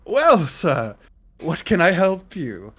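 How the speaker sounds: loud, bright innkeeper greeting; bartender voice. Acted. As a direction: call out like a cheery barkeep. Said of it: "the bartender looks up and go".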